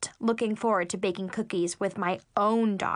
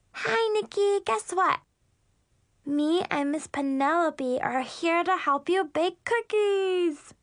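put-on high-pitched, bubbly little-girl voice; excited little sister; 7 semitones higher than usual. Little sister offering to help bake cookies, bubbly. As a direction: chirp it high, slowing into a singsong finish.

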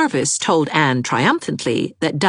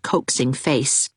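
usual speaking voice

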